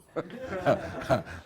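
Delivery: high, hearty burst of laughter; loud delighted laugh; big laugh. Real, not acted. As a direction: crack up at the punchline. Laughs at own story.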